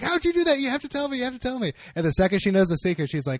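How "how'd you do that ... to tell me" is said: high-pitched, amazed imitation. Woman begging a magician for his trick's secret, amazed. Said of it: "she's, like, amazed".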